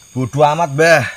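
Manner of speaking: loud, high call to the spirit; shouting. Pretend. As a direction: shout the offer defiantly.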